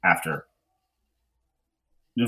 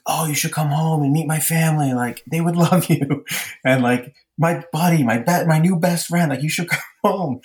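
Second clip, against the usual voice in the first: gushing, high-pitched invitation to a new best friend; excited invitation; 9 semitones higher, 4 dB louder than usual. Retold or imitated. Inviting a new buddy home to meet family, excited. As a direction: eagerly urge a friend along, pitched high. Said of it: "I'm so excited to introduce Tim".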